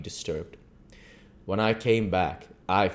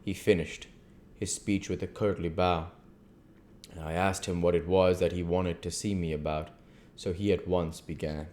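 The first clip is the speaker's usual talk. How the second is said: ordinary delivery, nothing marked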